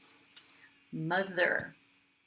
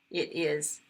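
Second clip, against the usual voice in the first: ordinary delivery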